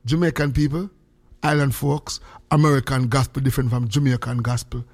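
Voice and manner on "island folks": ordinary talk, nothing marked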